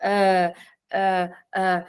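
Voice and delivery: low, repeated French "uh" with no closing m; "uh" as a French euh, held as if stuck in thought; French thinking filler. Shown as a demonstration. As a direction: mull it over with a French "uh". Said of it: "if I were thinking in French".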